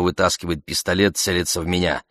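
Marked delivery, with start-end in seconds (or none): none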